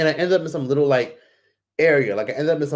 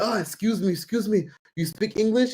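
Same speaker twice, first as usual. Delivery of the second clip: higher-pitched imitation of a man approaching; eager young stranger; 8 semitones higher than usual. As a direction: mimic a stranger trying to get attention. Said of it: "this one guy walks up to me".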